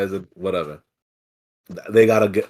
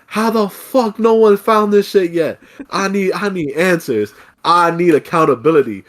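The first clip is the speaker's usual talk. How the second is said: Karen rant; 6 semitones higher than usual. Retold or imitated. Caller tearing into the FedEx agent, angry Karen. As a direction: go full entitled customer. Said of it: "start going to town on her".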